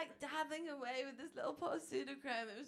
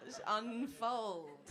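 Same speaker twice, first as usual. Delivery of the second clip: laughing through the words, drawn out slowly; giggling it out slow; 3 dB louder, much slower than usual. Real, not acted.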